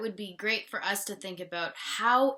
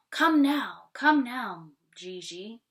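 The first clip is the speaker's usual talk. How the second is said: urging call; 4 semitones higher, 7 dB louder than usual. Acted. Mother urging her daughter, raised then softening. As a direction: hurry the child along.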